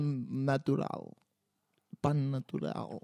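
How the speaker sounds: stretched-out musing; slow drawl. Real, not acted. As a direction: drag the words slowly. Drawn-out musing words. (alargando las palabras)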